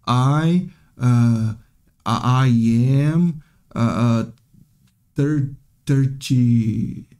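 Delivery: imitating a halting beginner, long drawn-out syllables; struggling to speak. Teacher mimics a learner asked her age, halting and hesitant. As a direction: stumble and stretch each word, searching for it. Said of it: "ela não consegue falar direito".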